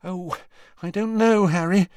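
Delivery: high, wavering reluctance; uncertain. A narrator's reading. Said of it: "looking uncertainly towards Missus Weasley".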